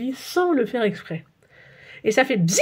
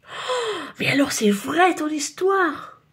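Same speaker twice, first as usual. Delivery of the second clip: much higher, slow, wide-eyed; amazed; 7 semitones higher, slower than usual. A narrator's reading. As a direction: gasp at the truth. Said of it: "dit Clotaire".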